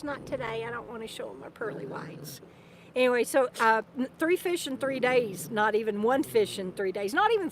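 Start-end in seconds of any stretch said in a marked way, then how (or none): 0.0-2.4 whispered dog voice, pretend; dog's voice. Voicing her dog refusing to smile, in a whisper. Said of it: "He says".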